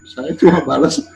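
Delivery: ordinary delivery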